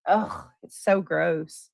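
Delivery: ordinary talk, nothing marked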